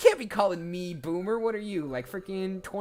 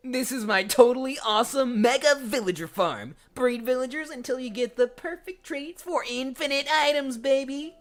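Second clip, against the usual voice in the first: higher, louder cocky showman voice; bragging Steve; 8 semitones higher, 5 dB louder than usual. Shown as a demonstration. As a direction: show off your farm proudly. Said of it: "I am Steve".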